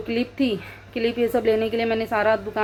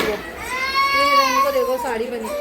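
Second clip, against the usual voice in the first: 1 delighted gush; 12 semitones higher, slower than usual. Real, not acted. Delighted at child in sari.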